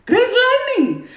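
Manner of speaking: high, loud and slow, breaking into a laugh; loud high exclamation with a laugh; loud laughing belt. Acted. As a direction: belt it out then crack up. Belting song title with laugh.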